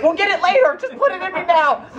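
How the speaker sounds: high, laughing frantic plea; mock panic. Friend desperate to hide the camera cards, mock panic. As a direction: play up the desperation, half laughing.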